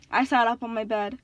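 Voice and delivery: plain reading voice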